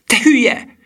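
very high, loud outburst; exclaiming insult. A narrator's reading. Disguised Gustav to his friend, excited exclamation. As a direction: pitch leaping up, loud and incredulous.

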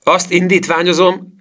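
high, loud proclamation; indignant shout. A narrator's reading. Professor moving against Petzold, indignant shout. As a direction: call out the motion, pitch way up. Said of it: "kiáltotta a professzor".

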